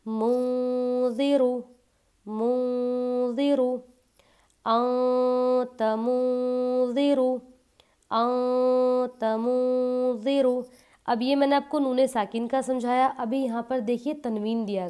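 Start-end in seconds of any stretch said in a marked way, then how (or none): 0.1-10.6 मुंज़िरु very slow, the न hidden nasally; with ghunna. Shown as a demonstration. Teacher demonstrates the ikhfa to students, slow and nasal. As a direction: draw out अंत मुंज़िरु with a nasal hum. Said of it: "किस तरह से".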